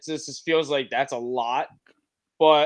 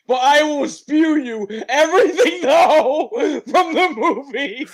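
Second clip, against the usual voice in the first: words squeezed out between laughs; laughing while talking; 9 semitones higher, 6 dB louder than usual. Real, not acted. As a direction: laugh through the quote, breaking up. Quoting a guy, cracking up.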